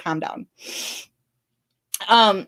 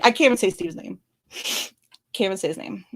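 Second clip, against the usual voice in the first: tearful; 3 semitones lower, 4 dB quieter than usual. Real, not acted. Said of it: "'cause I'll just start crying again".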